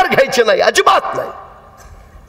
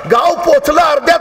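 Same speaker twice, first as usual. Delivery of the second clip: normal talking voice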